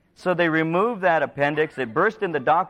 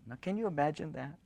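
usual speaking voice

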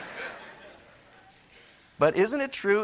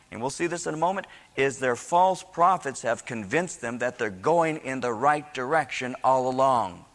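normal talking voice

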